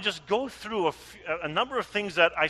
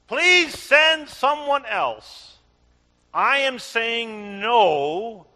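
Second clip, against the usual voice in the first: Moses pleading; 5 semitones higher, 6 dB louder, much slower than usual. Acted.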